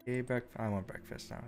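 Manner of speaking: usual speaking voice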